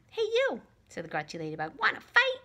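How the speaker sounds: very high, loud challenge; grouchy ladybug voice. Acted. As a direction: squeak out a cocky challenge. Grouchy ladybug picks a fight.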